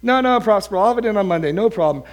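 high, breezy student brush-off; cavalier. Acted. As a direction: wave the professor off, totally unworried. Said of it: "sort of cavalierly walk by".

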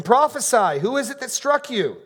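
high, loud mocking taunt; jeering shout. Soldiers taunting their blindfolded captive, loud jeering. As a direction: shout it as the tormentors would.

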